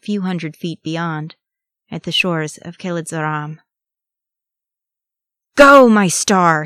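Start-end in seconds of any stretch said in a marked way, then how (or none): 5.5-6.6 slow, loud battle roar. A narrator's reading. Urging a loved one onward in flight, roaring. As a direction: roar it out, stretching the words. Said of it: "he roared".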